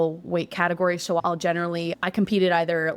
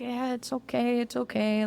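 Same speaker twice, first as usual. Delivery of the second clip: soft, higher, soothing voice; comforting; 5 semitones higher, 4 dB quieter than usual. Retold or imitated. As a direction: gently reassure a crying friend. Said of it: "comforting me".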